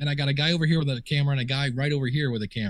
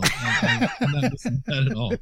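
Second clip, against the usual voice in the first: laughing while talking. Real, not acted. Laughs about five-foot commute.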